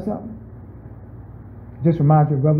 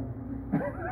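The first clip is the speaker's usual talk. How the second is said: silent wheezing laugh, pitched high; laughing at the brother's request; high breathless laugh; 15 dB quieter than usual. Real, not acted. Pastor laughs at own story.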